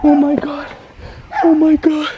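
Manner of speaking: usual speaking voice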